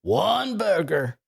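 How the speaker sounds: hammed-up slow yell-back; cook yelling back. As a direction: stretch each word like a cook calling an order. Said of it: "yells back".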